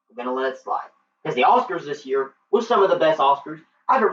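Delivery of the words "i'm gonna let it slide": softer, low repeat; quietly conceding the point; subdued concession. Real, not acted. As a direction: drop the voice and let it go. Conceding, second take subdued.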